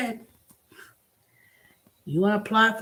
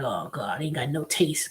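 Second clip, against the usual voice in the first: much lower, softer grumble; grumbling dismissal; 6 semitones lower, 4 dB quieter than usual. Retold or imitated. Dismissing diet drinks, low grumbling groan. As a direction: groan it like diet food disappoints. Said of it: "I was like".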